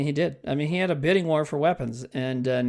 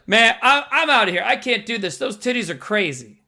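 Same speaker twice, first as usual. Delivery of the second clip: raised, louder voice of a guy bailing out; mock panic; 5 semitones higher, 6 dB louder than usual. Retelling a man fleeing the theater, mock freak-out. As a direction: play a moviegoer who can't take it.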